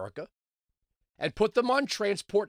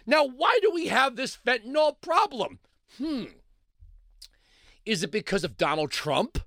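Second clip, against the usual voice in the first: ordinary talk, nothing marked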